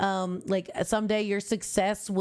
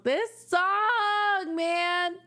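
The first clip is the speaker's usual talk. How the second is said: much higher, emotional gush; overwhelmed gush; 9 semitones higher, much slower than usual. Real, not acted. Fan overwhelmed by the song, gushing high. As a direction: let it burst out of you, pitched way up.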